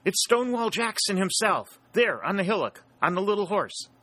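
high, excited calling out; wild shout. A narrator's reading. Pennington points out Jackson on the hillock, wild excited cry. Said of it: "utter a wild shout".

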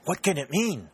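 high, startled question; alarmed exclamation. A narrator's reading. Woman startled by a heavy knock at the door, exclaiming in alarm. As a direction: exclaim it with a sharp lift in pitch. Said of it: "exclaimed Miss Grayson".

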